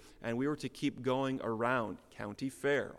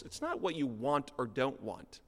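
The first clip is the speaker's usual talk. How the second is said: usual speaking voice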